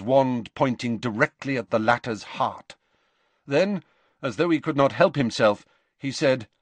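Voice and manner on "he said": ordinary delivery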